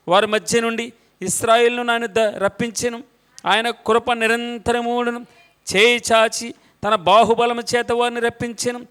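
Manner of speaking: measured, solemn reading of the psalm with a steady refrain; responsive scripture reading. Acted. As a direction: read each verse and land firmly on the refrain. Reads Psalm 136 responsively.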